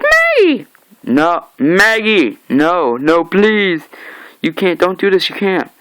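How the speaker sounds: high, loud acted cries of protest; desperate pleading. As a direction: cry out and beg them to stop. Acting out victims' desperate pleas.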